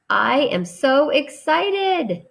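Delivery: high, slow gushing exclaim; hammed-up excited read. Shown as a demonstration. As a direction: make it burst with excitement. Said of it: "Hannah exclaimed".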